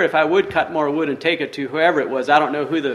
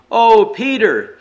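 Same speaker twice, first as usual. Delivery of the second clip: sobbing; 5 semitones higher, 5 dB louder, slower than usual. Acted. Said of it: "he sobbed".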